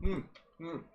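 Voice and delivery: talking through a mouthful of food; muffled, mouth full of food. Real, not acted. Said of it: "I can't talk with my mouth full".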